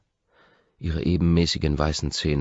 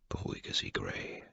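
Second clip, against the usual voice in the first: whispered inner voice; 8 semitones lower, 13 dB quieter than usual. Acted. Inner voice calming himself.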